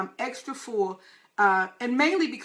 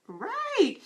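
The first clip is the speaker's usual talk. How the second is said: emphatic; 11 semitones higher than usual. Real, not acted. Answering own question emphatically.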